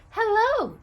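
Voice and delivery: high, sweet little angel greeting; tiny angel voice. Acted. Tiny angel greeting a sad boy, high and sweet.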